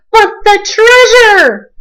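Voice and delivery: high, loud, slow dismayed holler; captain wailing for his treasure. A narrator's reading. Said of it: "hollered Braidbeard".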